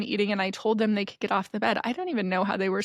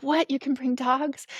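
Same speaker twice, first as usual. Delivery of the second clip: mildly incredulous, raised pitch; surprised; 4 semitones higher than usual. Retold or imitated. Guest stepping out of the van sees a puppy, surprised. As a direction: ask it in retold disbelief. Surprised that dogs are allowed.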